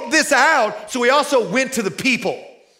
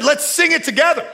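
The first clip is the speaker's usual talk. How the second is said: rousing call; 7 semitones higher than usual. Retold or imitated. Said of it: "he said".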